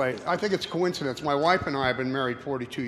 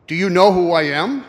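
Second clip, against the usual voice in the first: booming, much louder devil demanding; Satan's booming voice; 3 semitones higher, 14 dB louder than usual. Acted. Satan booms at the unafraid cowboy, loud and menacing. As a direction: loom over him and boom it out.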